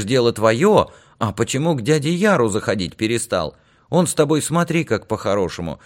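high-pitched village woman; high, animated aunt recounting a scolding; talkative aunt, puzzled and chiding. Acted. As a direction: chatter on in a high voice, reproachfully. Aunt tells about her nephew.

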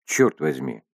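usual speaking voice

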